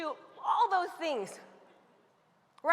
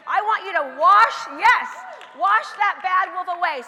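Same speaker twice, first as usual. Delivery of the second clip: loud, high urging call; 4 semitones higher, 9 dB louder than usual. Acted. Speaker urges the audience to wash the bad wolf away, shouting. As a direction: push the crowd hard, full voice.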